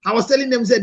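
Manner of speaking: usual speaking voice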